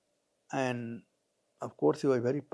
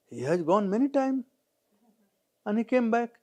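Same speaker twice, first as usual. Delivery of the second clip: put-on higher childlike voice, naive logic; small boy; 8 semitones higher than usual. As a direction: argue like a clever little boy. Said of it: "Jamraj came as a small boy".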